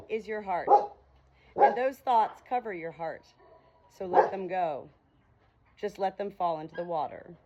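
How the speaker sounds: calm, measured book reading; reading aloud. Acted. Reads book aloud to hens.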